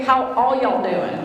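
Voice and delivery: slow Louisiana drawl on How all y'all doing; a proud Cajun hello; Louisiana greeting. Shown as a demonstration. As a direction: stretch it out slowly, Southern Louisiana style. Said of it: "But in Louisiana, we say".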